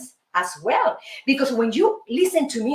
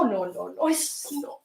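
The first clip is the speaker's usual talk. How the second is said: ordinary talk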